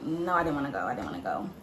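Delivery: low, soft grumbling refusal; retold reluctant grumble; irritated.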